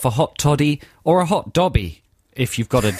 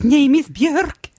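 very high, slow pixie voice; 13 semitones higher than usual. Retold or imitated. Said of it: "that's your [name] impression".

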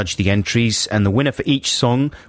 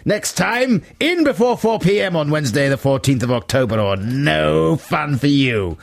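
mock-harsh rejection; 5 semitones higher than usual. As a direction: scold with hammed-up strictness, stretching the ends.

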